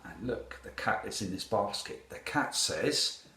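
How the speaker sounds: ordinary delivery, nothing marked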